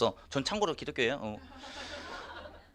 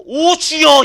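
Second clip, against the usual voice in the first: 높고 크게 주여 하고 부르짖기; 십자가 앞에서 부르짖듯; 12 semitones higher, 15 dB louder, much slower than usual. Retold or imitated. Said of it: "이거만 하고 있어". (십자가 앞에서 부르짖는 아내 흉내, 높고 큰 외침)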